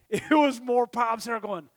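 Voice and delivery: ordinary talk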